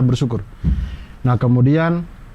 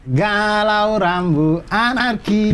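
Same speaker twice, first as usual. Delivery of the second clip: drawn out; 7 semitones higher, much slower than usual. Real, not acted. Drawn-out echo of phrase.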